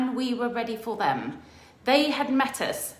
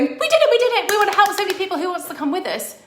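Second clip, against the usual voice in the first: high, loud, victorious shouting; retold triumphant shouting down the mountain; triumphant cheer; 11 semitones higher, 10 dB louder, faster than usual. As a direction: celebrate the summit at full blast.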